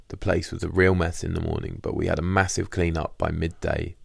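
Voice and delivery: ordinary delivery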